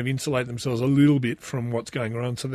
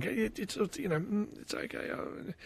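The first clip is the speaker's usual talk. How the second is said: hushed calming; 6 semitones higher, 13 dB quieter than usual. Retold or imitated. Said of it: "And I'm going".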